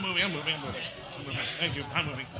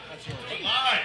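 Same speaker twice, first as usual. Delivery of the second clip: ordinary talk, nothing marked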